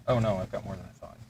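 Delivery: normal talking voice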